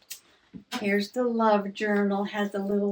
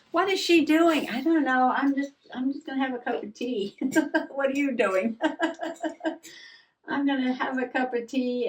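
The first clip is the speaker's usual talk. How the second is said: higher, faster little pet voice; cat's voice; 5 semitones higher than usual. Pretend. Voicing her cat's answer, higher little playful voice. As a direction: speak for the cat, high and quick.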